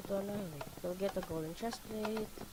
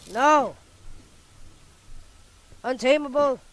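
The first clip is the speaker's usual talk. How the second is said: excited yell; 7 semitones higher, 15 dB louder than usual. Real, not acted. Game fight climax.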